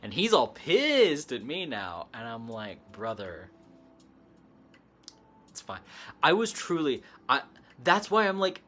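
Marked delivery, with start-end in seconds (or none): 2.9-5.8 soothing. Retold or imitated. Teller calming angry investigator.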